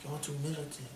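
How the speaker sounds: hushed mocking impression; soft mock quote. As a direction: say it softly, mocking his gentleness. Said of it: "he said it soft like that".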